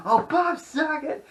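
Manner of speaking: very high, slow drawn-out cry; high mock howl of the name; Tourette's guy catchphrase. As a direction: wail the name like a cartoon outburst. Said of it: "it's like".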